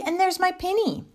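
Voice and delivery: put-on high, delighted child's exclamation; excited cry. A narrator's reading. Lucy spotting her own pinny, high excited cry. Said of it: "cried Lucy".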